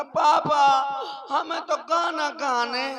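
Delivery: high, loud call, slow, laughing through it; loud childish call. Retold or imitated. Boy yells at his father in bed, loud and laughing. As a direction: yell for papa, half laughing.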